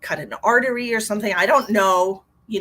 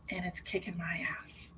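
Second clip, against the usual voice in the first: whisper; 13 dB quieter than usual. Real, not acted. Joking aside about pizza pain.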